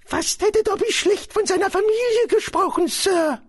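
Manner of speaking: very high-pitched elf voice; high, pleading creature voice; Dobby, contrite house-elf. Acted. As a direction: confess it as a servile little elf.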